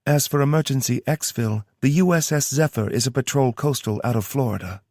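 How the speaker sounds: brisk briefing in a Southern twang; a Southern-twanged logistics officer; Tara's Southern twang. Acted. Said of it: "the twang in her accent".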